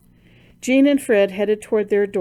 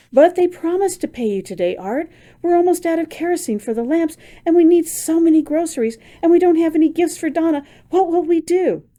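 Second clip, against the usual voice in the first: high, louder, rushing desperation; distressed pleading; 9 semitones higher, 5 dB louder than usual. Acted. Wife to husband after no pay, desperate pleading. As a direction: plead as the worries pour out.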